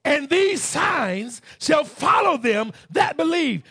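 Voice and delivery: loud, high, slow proclamation; preaching at full force. Shown as a demonstration. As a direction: declare it like the voice of Jesus. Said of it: "Jesus is talking".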